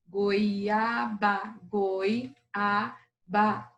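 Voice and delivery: "Goiaba" broken into goi, a, ba; syllable by syllable. Shown as a demonstration. Teacher demonstrating the word's syllables, "goiaba" split up. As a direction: sound out "goiaba" in three distinct beats. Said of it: "Vamos ver então agora a palavra goiaba".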